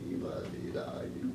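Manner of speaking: breathy whispered tease; teasing whisper. Real, not acted.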